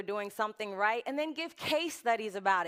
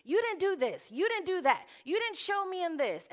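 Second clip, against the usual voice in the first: higher-pitched mock-angry accusations; mad rant; 8 semitones higher than usual. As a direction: fire off complaints, imitating your own past outrage. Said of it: "guys, I was so mad".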